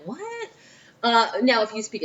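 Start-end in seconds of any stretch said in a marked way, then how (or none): 0.0-0.5 high, soft, puzzled "what?"; mock bafflement. Modern Roman hears someone speak Latin, mock bafflement. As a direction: squeak it softly, as if totally lost.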